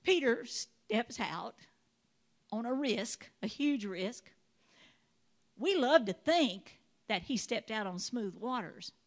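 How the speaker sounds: ordinary talk, nothing marked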